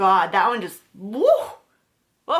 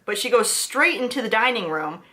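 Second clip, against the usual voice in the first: normal talking voice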